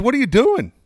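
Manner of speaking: high, loud disbelief; imitated alarm. Friend learns the whole cookie was eaten, incredulous.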